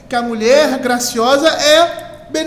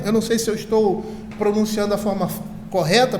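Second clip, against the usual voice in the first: ordinary delivery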